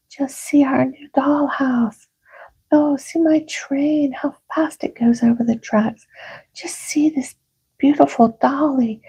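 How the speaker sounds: high, eager voices; excited children. Acted. Children showing new toys, high and eager. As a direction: show off new toys, thrilled.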